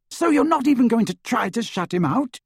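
high-pitched, accusing question; indignant. A narrator's reading. Hermione challenging Harry in a quarrel, high and indignant.

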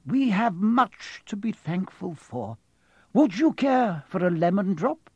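high, leisurely, courteous wizard; serene old wizard. Acted. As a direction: play a placid wizard making a polite offer. Dumbledore voiced in the story.

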